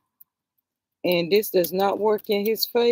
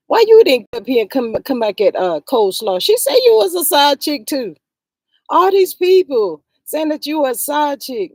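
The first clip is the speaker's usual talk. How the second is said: louder, higher, fast exasperated rant; heated scolding; 5 semitones higher, 8 dB louder than usual. Real, not acted. Vlogger loudly scolding Kimmy for not defending herself. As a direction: let it rip at her, exasperated.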